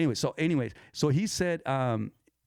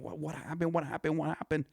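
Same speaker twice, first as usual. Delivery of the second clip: high, softened mock alarm; imitated alarmed onlookers; 5 semitones higher, 5 dB quieter than usual. Bystanders all start questioning the injured kid, mock alarm. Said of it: "they all start, you know, going".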